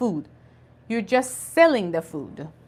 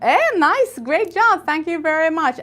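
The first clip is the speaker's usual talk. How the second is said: excited praise; 6 semitones higher, 4 dB louder than usual. Real, not acted.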